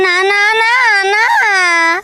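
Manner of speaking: high, wailing string of noes; tearful refusal. Acted. Wife refusing husband's promise, whining in tears. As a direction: cry no, stretching it out.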